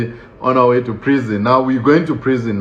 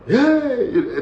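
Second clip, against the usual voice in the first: high-pitched, drawn-out holler; mock warder scream; 11 semitones higher than usual. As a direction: holler like a rude prison warder. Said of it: "they start screaming".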